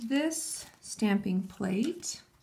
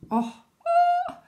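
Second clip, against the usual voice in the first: high, loud delighted squeal; excited squeal; 6 dB louder than usual. Real, not acted. Nail vlogger squeals over the shiny stamp.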